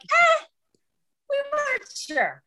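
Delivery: high hedging eh, sheepish; sheepish shrug. Retold or imitated. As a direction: shrug it off awkwardly. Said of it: "they were like".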